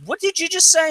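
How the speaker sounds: daring him to repeat it; indignant challenge. Retold or imitated. Zero confronting the foul-mouthed customer, indignant and high. Confronting the teen who cursed.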